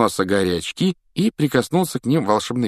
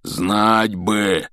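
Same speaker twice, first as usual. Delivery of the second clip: slow, rumbling giant's voice; a big gamekeeper talking it over slowly; Hagrid voice. Acted. Hagrid explains slowly to Harry, rumbling.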